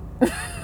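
tiny high-pitched giggle; a quick squeal of laughter; high squeaky laugh. Real, not acted. Laughing at monocle joke.

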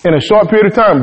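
ordinary delivery

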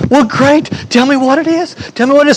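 high-pitched, eager urging; acted eager excitement, pitched high; excited neighbor. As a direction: beg to be let in on it, caught up in the excitement. Neighbor caught up in her excitement.

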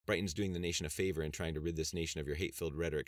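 plain reading voice

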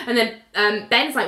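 normal talking voice

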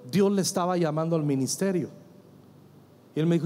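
usual speaking voice

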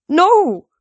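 high, loud anguished refusal; trembling. A narrator's reading. Satyr refusing his god's wish to die, trembling. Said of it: "Grover's voice trembled".

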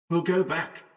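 high, soft far-off shout; distant shout. A narrator's reading. Howard out in the fog, a faint distant shout. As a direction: shout as if from far away, held back in volume. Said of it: "It was Howard shouting now".

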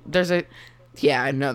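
normal talking voice

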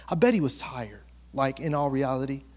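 usual speaking voice

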